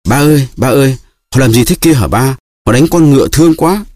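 put-on boy crying out to his father; child crying out. A narrator's reading. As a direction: plead with father in alarm. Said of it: "Raskolnikov kêu lên".

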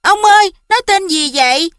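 put-on high, eager child voice; small boy calling to grandpa, pitched high; little boy. A narrator's reading. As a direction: pipe up like a curious kid. Little boy asks grandpa a question.

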